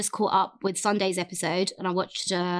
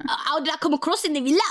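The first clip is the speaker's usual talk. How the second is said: quick, higher-pitched mock regional accent; hammed-up thick accent, higher and faster; Brad's accent; 6 semitones higher, faster than usual. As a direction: rattle it off in a put-on thick accent, half laughing at yourself. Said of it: "I can't do my Brad accent".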